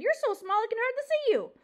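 high, loud sudden demand. Acted. Porcupine to tiny mouse, demanding. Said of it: "Aerith suddenly demanded".

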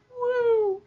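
very high whoop; celebratory woo. Real, not acted. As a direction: cheer it out high and loud.

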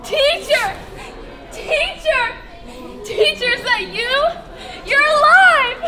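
high, loud astonished cries of joy; elated shouting, voice leaping up; overjoyed recognition. Acted. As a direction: call out in amazed delight. Recognises the risen Jesus alive.

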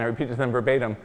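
ordinary delivery

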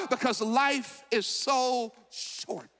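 normal talking voice

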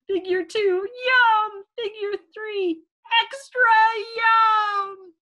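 high, building exclamations; excited storytelling. A narrator's reading. Storyteller showing Little P's clean plate, excited yums. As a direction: build each yum up to a shout. Delighted eating at story climax.

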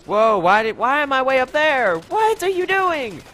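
very high, loud squeal, slowing at the end; shocked high squeal. Real, not acted. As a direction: shriek in disbelief. Launched up high in game.

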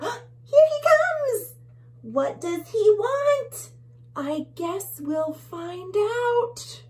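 high, slow breathless excitement; excited suspense. Acted. Reader to children as the bully bug comes, high and slow. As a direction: thrill the kids as he approaches.

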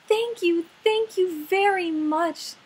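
high, loud bubbly thanks. Acted. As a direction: gush with delight. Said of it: "bubbled playfully".

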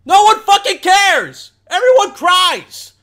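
very high, loud yell; exasperated shout. Real, not acted. Reactor fed up with a crying video, shouting.

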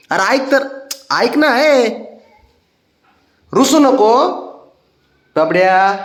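high, pleading sing-song to a pet. Real, not acted. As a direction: coax him sweetly not to sulk.

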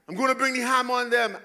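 hammed-up exasperation, fed up; exasperated God. Pastor voicing God fed up with the people, loud exasperation. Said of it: "That's just me".

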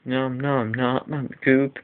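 drawn-out nom noises; slow mock munching. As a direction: chew the words slowly like eating. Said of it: "The cat will be like".

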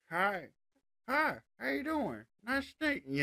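밝고 높은 시골 말투의 지미; country voice. Shown as a demonstration. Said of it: "like, a more country voice".

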